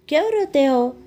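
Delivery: loud, high angry shout. A narrator's reading. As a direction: yell it at the crying kids. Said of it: "चिल्लाकर".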